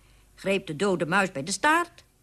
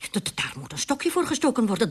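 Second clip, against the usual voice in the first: indignant stammer; 6 semitones higher, faster than usual. Acted.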